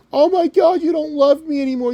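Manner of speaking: high-pitched, wailing mock heartbreak; mocking high voice, loud at the start; mock whiny complainer. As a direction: whine like a jilted lover, hammed up. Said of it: "this is how I'm gonna translate it for them".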